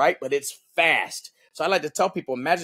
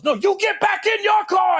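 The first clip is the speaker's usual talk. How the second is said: much higher, louder yelled retort; road rage shout; 4 dB louder than usual. Retold or imitated. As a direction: scream it back in blind rage. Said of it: "the dude that was road raging".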